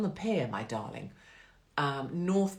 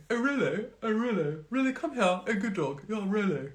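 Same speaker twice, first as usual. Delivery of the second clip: sing-song posh calling of a pet; posh dog owner; 5 semitones higher than usual. Retold or imitated.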